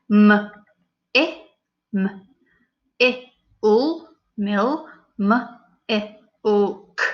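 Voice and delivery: each sound said separately, stretched; sounding out letters. Shown as a demonstration. Teacher sounding out letters for pupils. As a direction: segment the word sound by sound. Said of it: "we're saying each individual sound".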